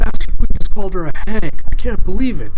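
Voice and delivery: high, loud, fast gasp of disbelief; shocked minions. Acted. Minion squealing to his crew about the insult, high and fast. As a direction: squeal it like a scandalised henchman.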